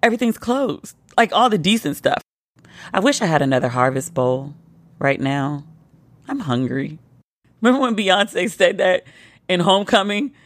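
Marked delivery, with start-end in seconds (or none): none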